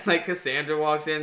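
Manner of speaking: usual speaking voice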